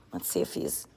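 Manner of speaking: hushed whisper so the cat stays put. Real, not acted. Creeping toward a shy cat, whispering. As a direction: breathe it out without voice.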